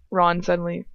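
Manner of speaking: ordinary delivery, nothing marked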